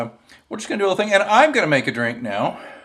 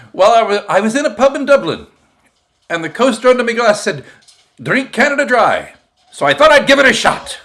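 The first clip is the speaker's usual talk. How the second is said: Irishman; 7 semitones higher, 7 dB louder than usual. Acted. Joke punchline, Irishman quoted.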